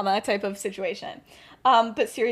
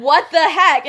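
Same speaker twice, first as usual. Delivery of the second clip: loud, raised-pitch disbelief; shocked outburst; 4 semitones higher, 10 dB louder, slower than usual. Acted. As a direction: exclaim it too loudly, acting stunned. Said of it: "Keep your voice down".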